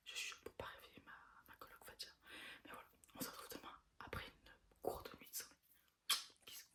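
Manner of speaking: soft whisper, out of breath. Real, not acted. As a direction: whisper so the roommate keeps sleeping. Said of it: "je chuchote, je suis essoufflée".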